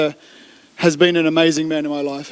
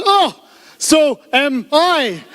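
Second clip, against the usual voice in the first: very slow, pitched way up; really slow speech; 8 semitones higher, much slower than usual. Acted. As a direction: stretch each word high and slow. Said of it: "just speak really slowly".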